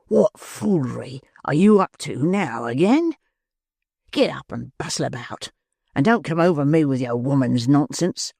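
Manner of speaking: lightly voiced brute scolding; savage. A narrator's reading. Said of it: "in a savage voice".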